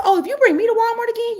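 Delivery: exasperated fast comeback; indignant. Retold or imitated. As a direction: fire it back high and quick. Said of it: "I said".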